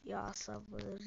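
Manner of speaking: under the breath, begging for patience; muttered patience. Real, not acted. Gamer fed up with teasing players, muttering.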